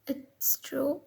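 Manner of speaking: soft unvoiced whisper; hushed, guilty whisper; whispered denial. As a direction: breathe the denial out. Denying while being confronted.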